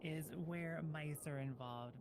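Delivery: normal talking voice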